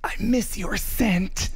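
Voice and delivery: slow mock-romantic creep; creepy tease. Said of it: "I was teasing him".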